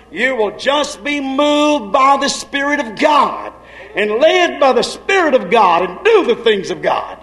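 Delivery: slow, ringing shouted line; preaching shout. Acted. Preacher shouting to congregation about the Spirit. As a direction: cry it out with fervor.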